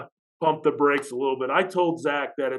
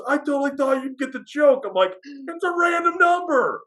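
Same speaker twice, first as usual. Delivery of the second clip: exasperated shout; 13 semitones higher than usual. Retold or imitated. Exasperated retelling to Zach.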